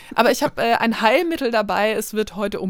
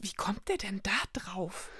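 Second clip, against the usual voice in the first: hushed whispered question; 12 dB quieter, slower than usual. Real, not acted. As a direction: whisper it slowly, conspiratorially.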